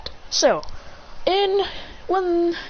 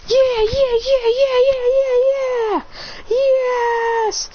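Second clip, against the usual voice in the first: mock victory screaming; 13 semitones higher, much slower than usual. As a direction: act out a gamer's wild celebration. Celebrating beating the level.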